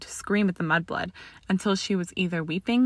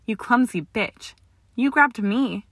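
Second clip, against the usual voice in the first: indignant protest; 5 semitones higher, 3 dB louder, slower than usual. A narrator's reading. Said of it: "she protested".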